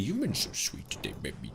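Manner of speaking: put-on softened, lisping baby-talk coo; cutesy baby talk. Streamer to a generous viewer, cutesy baby talk.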